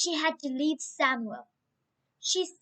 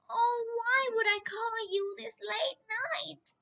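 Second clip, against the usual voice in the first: tired voice; 7 semitones higher, 4 dB quieter than usual. Acted. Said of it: "I'm tired".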